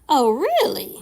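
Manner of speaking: imitated neighbor, pitch jumping up on "really"; intrigued, ready-to-act questioning; pointed "oh, really?". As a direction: echo him, suddenly very interested. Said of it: "like that".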